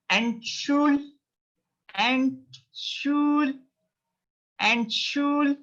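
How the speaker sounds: Entschul- held long on a high, level pitch; Entschul-, high and stretched on the second syllable; syllable breakdown. Shown as a demonstration. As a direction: chant Entschul- as "en-shool" for learners. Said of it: "try to read it".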